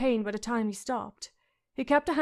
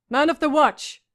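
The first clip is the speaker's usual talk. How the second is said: a louder barked rallying call; shouted; 4 semitones higher, 8 dB louder than usual. A narrator's reading. Commander rallies the gold cloaks, loud shout. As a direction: shout it to the men. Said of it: "Janos Slynt shouted".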